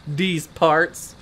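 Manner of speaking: "These" said as "deez", hard D; low mocking repeat, "These" with a D. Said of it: "might as well have just spelled it D E E Z".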